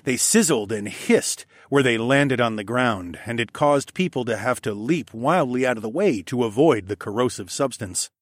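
ordinary delivery

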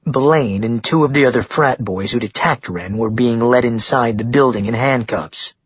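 plain reading voice

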